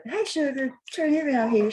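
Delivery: high sweet voice for the dog; pet talk. Real, not acted. Owner asking her dog to turn around, sweet pet voice. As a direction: coax the pup to turn.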